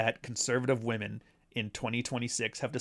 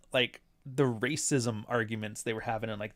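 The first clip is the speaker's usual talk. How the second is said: ordinary talk, nothing marked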